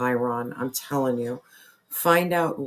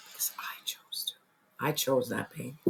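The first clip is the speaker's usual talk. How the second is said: hushed, almost unvoiced admission; whispered confession; 2 semitones lower, 9 dB quieter than usual. Real, not acted. Confessing a hard personal truth.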